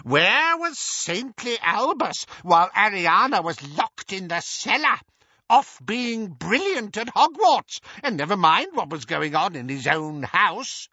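put-on high, loud, shrill old woman; piercing elderly indignation; accusing old aunt. A narrator's reading. Muriel presses her accusation.

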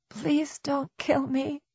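tiny high, soft, slow plea; small, humble. A narrator's reading. Terrified woman begging the man looming over her, small and humble. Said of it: "in a very small and very humble voice".

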